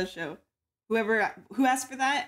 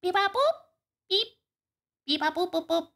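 robot beeps; 6 semitones higher than usual. Acted. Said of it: "your Terrence".

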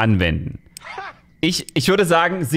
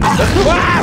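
startled cry; 14 semitones higher, 6 dB louder than usual. Real, not acted. Scared in horror game.

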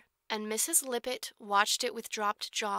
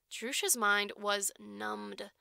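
plain reading voice

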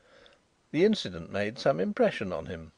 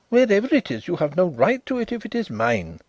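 high, louder, breathless push; building agitation; panting, rising excitement; 8 semitones higher, 6 dB louder than usual. A narrator's reading. Cornered visitor panting at Carrados, excited and loud. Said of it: "panted Drishna, with rising excitement".

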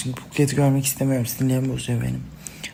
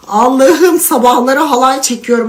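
loud exclaim; 6 semitones higher, 6 dB louder than usual. Real, not acted. Joking about her mood swings.

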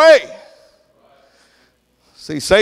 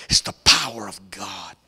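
whisper; 9 semitones lower, 6 dB quieter than usual. Acted. Preacher whispering scripture.

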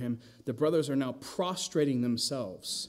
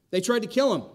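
emphatic punch; 6 semitones higher, 6 dB louder, faster than usual. Real, not acted. Preacher stresses the brothers' murder plot.

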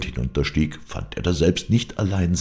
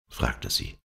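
ordinary delivery

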